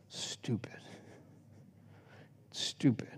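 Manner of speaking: whispered under the breath; whispered stupid, in disgust. Real, not acted. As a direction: hiss it to yourself, fed up. Muttering in disgust after long pauses.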